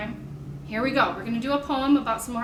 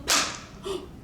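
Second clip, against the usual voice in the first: mock gasp; 7 semitones higher, 5 dB quieter than usual. Shown as a demonstration. That was loud.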